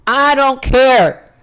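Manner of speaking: high, loud, slow shrug of a line; drawn-out loud don't-care; emphatic dismissal. Real, not acted. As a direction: stretch it out like it truly doesn't matter.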